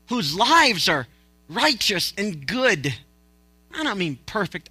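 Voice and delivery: normal talking voice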